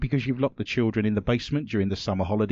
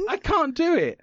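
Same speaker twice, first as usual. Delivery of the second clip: giving up. Real, not acted. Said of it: "How am I supposed to do Denmark".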